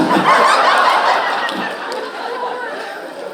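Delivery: squealing laugh at his own joke; high laugh. Real, not acted. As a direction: burst into a high, long laugh.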